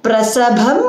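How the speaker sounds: Prasabham alone, ending on a clear m; separated word. Shown as a demonstration. Teacher splitting the line for students, Prasabham separate. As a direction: say Prasabham as its own word, lifting at the end. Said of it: "you can say prasabham upayatasya".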